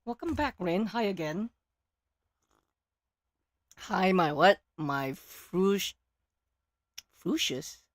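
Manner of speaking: ordinary talk, nothing marked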